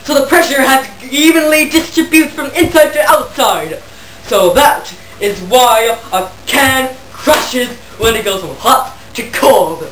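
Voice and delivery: normal talking voice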